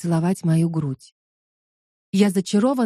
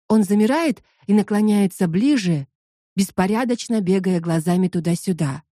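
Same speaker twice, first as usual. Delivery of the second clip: ordinary delivery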